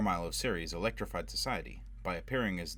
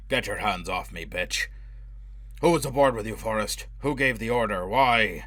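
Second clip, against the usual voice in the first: loud, savage demands, rising higher; furious interrogation; 3 semitones higher, 8 dB louder than usual. A narrator's reading. Foyle roaring questions at the drowning man, furious. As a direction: bellow the questions at him.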